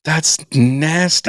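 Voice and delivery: grossed-out, dragged-out reaction; disgusted. Real, not acted. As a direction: stretch each word out in disgust. Disgust at the finger story.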